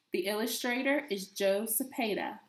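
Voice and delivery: usual speaking voice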